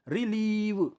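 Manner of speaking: "रिलीव" with a long, stretched ee; drawn-out vowel. Shown as a demonstration. Teacher showing students the long sound, "रिलीव" drawn out. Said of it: "बड़ा sound खींचना पड़ रहा है".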